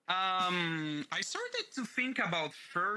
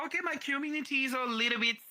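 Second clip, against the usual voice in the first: voiced self quote; 10 semitones higher than usual. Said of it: "I was like".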